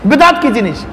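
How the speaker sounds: ordinary delivery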